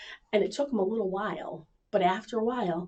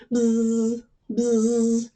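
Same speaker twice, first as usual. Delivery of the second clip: buzzing noise; 4 dB louder than usual. Shown as a demonstration. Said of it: "Can you make that noise for me".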